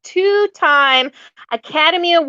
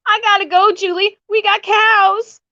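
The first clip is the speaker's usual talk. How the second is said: quoting Melissa; 8 semitones higher than usual. Retold or imitated. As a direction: play her earnest city-girl worry. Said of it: "Melissa delivers another fantastic line".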